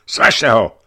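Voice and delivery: loud crowd shout; shouted order. A narrator's reading. Someone in the crowd ordering the arrest, shouting. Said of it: "křikl kdosi".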